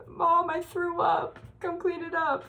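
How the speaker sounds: put-on high, slow pleading little kid; whiny sick kid voice. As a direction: whimper it like a sick child calling mom. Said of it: "I'm like".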